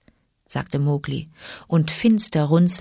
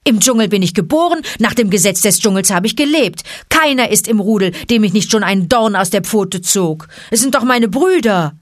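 loud, fast and raised; indignant; 5 semitones higher, 5 dB louder than usual. A narrator's reading. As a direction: insist hotly, pitch up. Mowgli protests to Bagheera.

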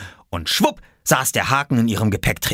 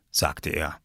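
usual speaking voice

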